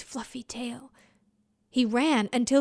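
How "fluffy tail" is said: whispered. A narrator's reading. Narration drops to whisper.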